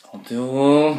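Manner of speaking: a hammed-up cry of "trente euros"; mock shocked viewer. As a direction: play the viewer gasping at the price. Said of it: "vous allez me dire".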